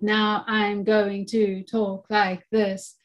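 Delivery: very slow, evenly beat-timed words; deliberately slowed, mechanical rhythm demo; robotic beat. Shown as a demonstration. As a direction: speak like a metronome, each syllable on the beat. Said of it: "we're not talking like robots".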